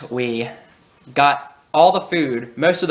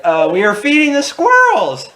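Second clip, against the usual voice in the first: put-on high, small innocent kid voice; sheepish kid; 12 semitones higher, 5 dB louder than usual. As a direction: play it as a guilty kid with a fake-innocent answer. Kids' sheepish answer to mom.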